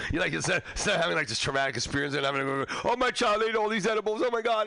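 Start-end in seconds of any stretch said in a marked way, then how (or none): 2.9-4.7 high, frantic mock mom; hammed-up panicking mother; panicked parent. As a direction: squeal it like a freaked-out parent.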